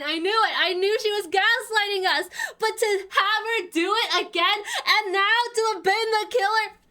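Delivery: aggrieved rant. Real, not acted. Ranting about the betrayal.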